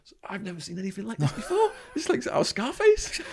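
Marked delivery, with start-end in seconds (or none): 2.0-3.3 much higher, laughing through it; laughing disbelief. Real, not acted. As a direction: giggle out the comparison. Laughing at the memory.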